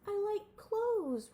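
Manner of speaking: a small proud voice; Wilbur's reply. A narrator's reading. Wilbur answers the mole rats, slow high proud. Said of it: "replied Wilbur".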